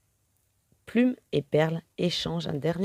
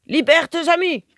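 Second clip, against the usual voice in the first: high, loud barked command; a pirate woman snaps an order to the boy; 11 semitones higher, 7 dB louder than usual. A narrator's reading. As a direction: order him sharply, like a pirate captain. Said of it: "ordonne-t-elle".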